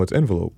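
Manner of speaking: "envelope" with an opening EN sound; Canadian pronunciation. Shown as a demonstration. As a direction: say "envelope" as EN-velope. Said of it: "'cause we're Canadian".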